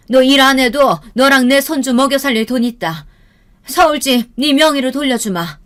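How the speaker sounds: high, emphatic commands; acted fiery, decisive scolding; angry mother. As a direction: order your daughter out with indignation. Mother orders daughter to divorce.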